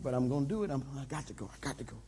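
inner monologue. Acted. Pastor grumbling in his head about the cost, muttered low and soft. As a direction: grumble to yourself, barely aloud. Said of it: "I'm in my head. I'm like".

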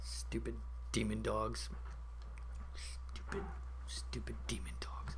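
very soft grumble; hushed, near-whispered grumbling; sulky muttering. Real, not acted. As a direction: mutter bitterly under your breath. Sulking after deaths.

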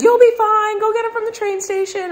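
put-on girly voice for landlord. Quoting her landlord assuring her about driving, high girly voice. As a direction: voice the guy like a chirpy girl. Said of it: "I just did that voice".

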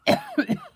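loud sudden sneeze; a sharp real sneeze; sneezing. Real, not acted. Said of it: "I'm gonna sneeze again".

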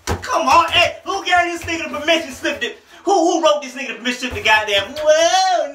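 high, loud, blown-away shouting; hyped disbelief. Real, not acted. Reviewer hollering over a slick rap bar, hyped disbelief. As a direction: holler like the bar just floored you.

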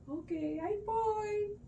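high, soft, drawn-out reply; obliging Rama figure. Acted. Rama sets off after the deer, singsong and obliging. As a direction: call it lightly while heading off.

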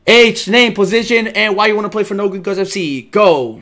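loud, high-pitched rapid-fire roll call; barked coach command. Pretend. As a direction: call it out like a coach running tryouts. Coach calling out tryout questions.

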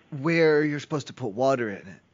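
usual speaking voice

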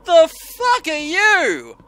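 slow, loud, high startled shout; scared horror game streamer. Real, not acted. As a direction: recoil from the monster. Monster appears in game.

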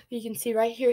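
usual speaking voice

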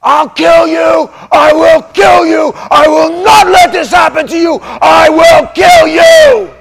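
high furious roaring threat; full-force shout of a furious father; father screaming. Retold or imitated. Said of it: "my father screaming from the other room".

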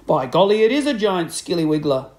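loud mock-alarmed old man. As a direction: fake a big fright. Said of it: "Mister Twit said".

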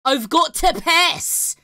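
loud, drawn-out Scottish-style accent; mock Scottish accent. Shown as a demonstration. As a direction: belt it out in an invented, Scots-like accent. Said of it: "that's, like, Scottish".

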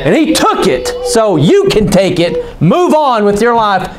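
high, emphatic pulpit exhortation; forceful preaching. Real, not acted. Exhorts the congregation.

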